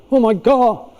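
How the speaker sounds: hammed-up alarm; panicking. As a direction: play a parent who thinks it's all failing. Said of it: "'Cause you think you're failing".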